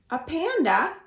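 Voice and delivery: plain reading voice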